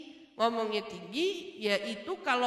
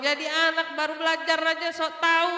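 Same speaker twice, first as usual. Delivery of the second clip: loud, raised-pitch rant of an acted father; scolding; 5 semitones higher, 6 dB louder than usual. Father to child who just started studying religion, scolding. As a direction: scold the know-it-all child loudly. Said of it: "makin ngomel katanya".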